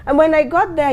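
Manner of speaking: normal talking voice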